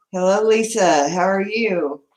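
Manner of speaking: ordinary talk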